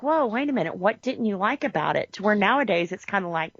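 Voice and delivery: usual speaking voice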